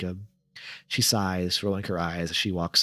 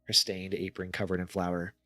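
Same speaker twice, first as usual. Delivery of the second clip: usual speaking voice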